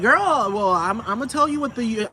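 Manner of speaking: acted comeback in a heated, high voice; hammed-up indignant argument; arguing back.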